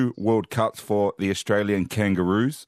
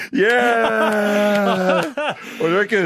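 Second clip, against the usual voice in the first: much higher, louder, still laughing; excited; 12 semitones higher, 5 dB louder, much slower than usual. Real, not acted. Host asking co-host for his verdict on the joke, elated. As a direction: shout it out, thrilled with yourself.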